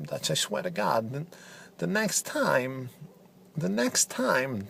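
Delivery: a hint of Christopher Walken, choppy pauses; deadpan Walken-style commentator. Walken impression, menacing.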